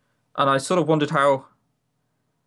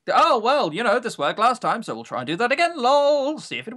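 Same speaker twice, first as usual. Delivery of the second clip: mock developer voice; 7 semitones higher than usual. Mocking developer's thinking.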